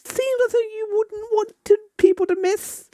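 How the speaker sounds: high, light voice for a story character. Acted. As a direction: read the NPC line up in a high register. High-voiced NPC dialogue read.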